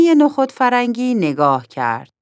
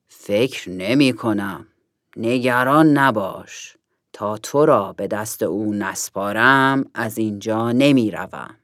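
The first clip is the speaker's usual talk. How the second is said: reassuring eagle; 7 semitones lower than usual. A narrator's reading. Golden eagle promising to stay with Nokhodi, low and reassuring. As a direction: reassure steadily in a deep character voice. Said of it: "عقاب طلایی گفت".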